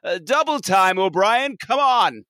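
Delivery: high-pitched, impatient mock order; hammed-up fed-up commander; exasperated captain. Host orders the transporter chief about, exasperated.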